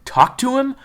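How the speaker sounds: P=A; insistent order. Acted. June insists again.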